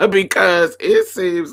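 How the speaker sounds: tickled, cracking up at your own remark; real laughter spilling into the sentence; laughing while talking. Real, not acted. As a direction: laugh through the words. Cracking up at her own title.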